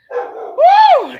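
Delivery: high, loud delighted woo; gleeful holler; excited whoop. Real, not acted. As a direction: whoop with joy. Loves the salt and pepper beard.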